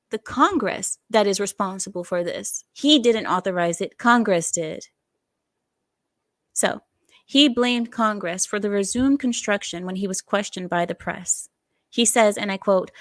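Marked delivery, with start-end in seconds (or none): none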